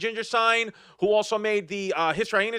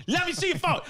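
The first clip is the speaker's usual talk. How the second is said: even higher, more insistent repeat; higher nagging demand; 10 semitones higher than usual. Retold or imitated. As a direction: pushier jealous partner, escalating. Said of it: "you're like".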